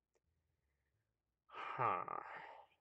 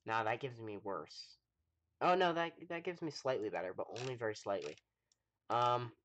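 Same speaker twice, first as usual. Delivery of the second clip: ordinary delivery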